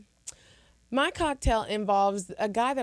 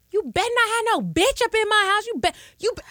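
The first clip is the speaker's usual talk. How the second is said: loud, high mock yell of a furious mother; imitating angry mom; 12 semitones higher, 5 dB louder than usual. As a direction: shout through the door like an angry mother. Angry mom banging on door.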